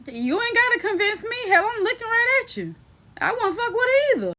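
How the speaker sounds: high-pitched put-on sassy retort; emphatic agreement. Acted reply agreeing about cat.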